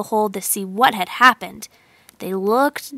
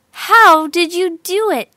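surprised; 6 semitones higher, 5 dB louder than usual. A narrator's reading. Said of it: "they asked".

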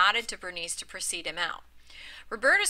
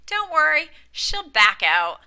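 louder, slow, contemptuous drawl; clear contempt; 7 semitones higher, 8 dB louder, slower than usual. A narrator's reading. Marjorie mocking Bernice to the group, slow contempt. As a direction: sneer it out slowly. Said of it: "very clear and contemptuous".

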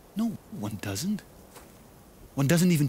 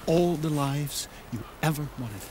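ordinary talk

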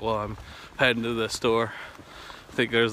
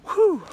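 high relieved whew; 7 dB louder than usual. Real, not acted. Relief arriving home.

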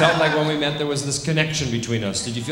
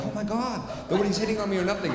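mock offended; 10 semitones higher, faster than usual. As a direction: act put-out in a high, lighter voice. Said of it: "I was, like, all offended".

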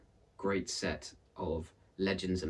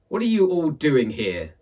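put-on sharp, louder, higher old woman; cross; 6 semitones higher, 9 dB louder than usual. Aunt Misery to visitors at her door, cross and loud. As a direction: snap at the unwanted visitors. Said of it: "she asked crossly".

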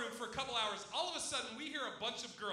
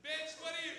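higher mock girl voice; breezy entrance as the second girl, pitched up; imitated girl; 5 semitones higher than usual. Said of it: "Lisa walks in and just goes".

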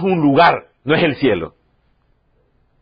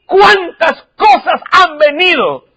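tearful cry; 5 dB louder, slower than usual. Real, not acted. Recounting family hardships.

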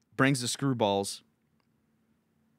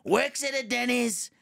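Donald Trump; 10 semitones higher than usual. Retold or imitated. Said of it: "I start Trump heckling him".

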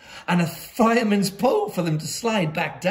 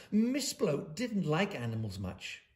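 ordinary delivery, nothing marked

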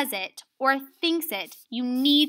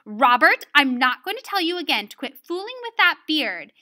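raised-voice teacher reprimand; scolding; 3 semitones higher, 6 dB louder than usual. Acted. Teacher scolding Robert for fooling with his beard, loud and firm. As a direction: scold a pupil firmly and loudly. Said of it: "She scolded".

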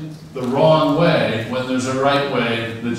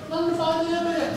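very high, quick squeaky-pitched take; a different tone; 4 dB quieter, much faster than usual. Shown as a demonstration. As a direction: fling it up into a tiny high voice, fast. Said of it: "what tone of voice you say".

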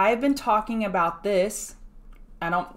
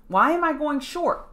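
incredulous question; 7 semitones higher than usual. Real, not acted. Rhetorical question to viewers.

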